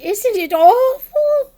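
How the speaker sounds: high, loud, slow wail; wailing, fearful small creature; Piglet frightened. A narrator's reading. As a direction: draw it out in horror. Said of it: "said Piglet".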